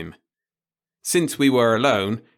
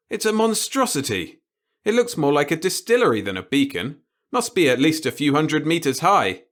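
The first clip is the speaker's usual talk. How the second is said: higher, exasperated protest; horrified repairman; 6 semitones higher, 4 dB louder than usual. Acted. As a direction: recoil at the blueprints.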